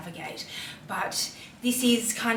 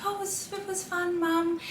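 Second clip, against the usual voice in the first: lightly voiced small girl, pitched higher; little daughter; 10 semitones higher than usual. Three-year-old tells mum about school, light higher child voice. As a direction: play the little girl brushing it off. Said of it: "and she was like".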